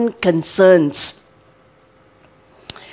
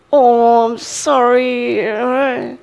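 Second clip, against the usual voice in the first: empathetic parent; slower than usual. Retold or imitated. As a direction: gently acknowledge the child's pain. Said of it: "you need to say".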